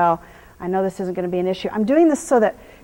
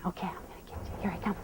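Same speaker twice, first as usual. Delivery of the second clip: unvoiced teasing whisper to the horse; 4 semitones lower, 16 dB quieter, faster than usual. Real, not acted. Trainer whispering playfully to her horse. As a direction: hush it like a game of tag.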